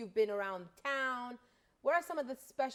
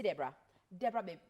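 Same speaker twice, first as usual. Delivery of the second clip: ordinary talk, nothing marked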